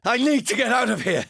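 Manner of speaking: high, loud desperate cry to get out; panicked shout. Acted. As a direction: shout it like you must flee now. Desperate plea to escape.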